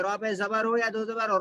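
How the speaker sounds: ordinary talk, nothing marked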